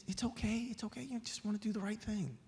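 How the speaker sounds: quiet, self-effacing reassurance; humble. Acted. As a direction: gently brush off the praise. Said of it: "the man was very humble".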